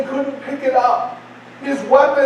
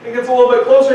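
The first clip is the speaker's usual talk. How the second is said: ordinary talk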